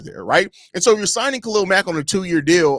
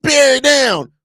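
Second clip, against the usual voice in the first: loud, heated rant at the fans; fed-up tirade, voice raised and higher; angry rant; 5 semitones higher, 3 dB louder than usual. Real, not acted. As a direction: yell at them like they've lost their minds. Ranting at fans.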